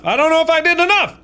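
high fast panicked rush. Real, not acted. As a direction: blurt it out in a rising panic.

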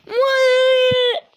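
high, loud, long-held "what"; shocked cry. Acted. Confronted by the villain, a shocked loud cry. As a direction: stretch it into an alarmed wail.

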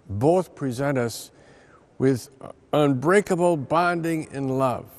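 ordinary talk, nothing marked

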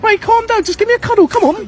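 high, loud teasing singsong; baby the tough guy in a high mock voice. Prankster taunting the angry man, high mocking voice.

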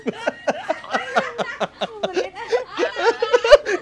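high, loud burst of laughter; laughing fit. Real, not acted. Husband revealing the prank to his wife, high loud laughing fit.

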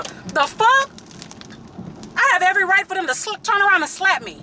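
incredulous and heated, rushing ahead; sharp outraged cry; outraged disbelief. Real, not acted. Outraged at the thought.

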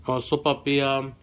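ordinary delivery

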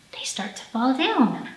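hushed storytime whisper. Pretend. Teacher whispering to children about leaves drifting down. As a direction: breathe it out softly like falling leaves.

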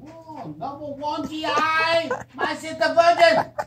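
high, slow mock Vietnamese accent; hammed-up Vietnamese accent. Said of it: "do that Vietnamese voice".

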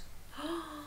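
soft storyteller's gasp; hushed intake of breath; shocked gasp. Acted. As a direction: gasp softly for a young audience.

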